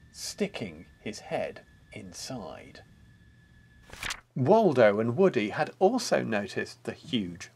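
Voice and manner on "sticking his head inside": whispered narration. A narrator's reading. Narrator hushing as the wolf peeks in, whispered. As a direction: hush it conspiratorially.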